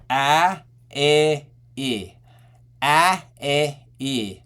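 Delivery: isolated AH, EH, EH, each held briefly; closed-belt vowels. Shown as a demonstration. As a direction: sound out "ah, eh, eh" as separate placed vowels. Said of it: "So for closed belt".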